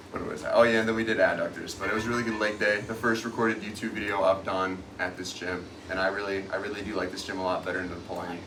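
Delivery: echoey locker room, far from mic; recorded in an echoey locker room. Real, not acted. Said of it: "We're still in the locker room right now".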